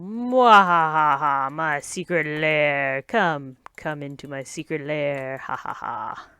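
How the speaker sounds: slow, loud hammed-up villain voice; sneering panto villain. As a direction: invite them in like a panto baddie. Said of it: "that was silly".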